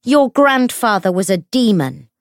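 high, loud denunciation; shouted accusation. A narrator's reading. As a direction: shout it for the whole crowd. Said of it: "she shouted at James".

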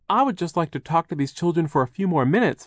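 lightly voiced Charles asking a favour; pleading Charles.

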